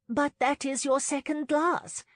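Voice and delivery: high, loud pointed remark; high-voiced matron pointing something out; observing matron. A narrator's reading. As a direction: point it out, pitched well up. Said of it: "Missus Clark observed".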